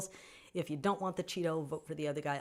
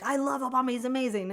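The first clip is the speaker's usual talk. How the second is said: gushing; 7 semitones higher, 5 dB louder than usual. Retold or imitated. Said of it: "And now he's like".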